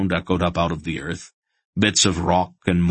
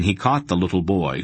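ordinary delivery

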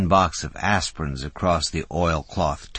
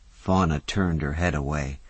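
ordinary delivery

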